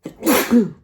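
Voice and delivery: loud sudden sneeze; real sneeze, sharp and loud; sneezing. Real, not acted. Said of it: "Et voilà, éternuement".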